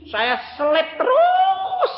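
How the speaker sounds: very high, slow, shouted boast; triumphant shout. Acted. Preacher shouting how he kept overtaking, very high and slow. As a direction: belt out the punchline.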